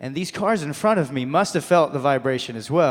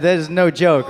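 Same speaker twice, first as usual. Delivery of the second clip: ordinary talk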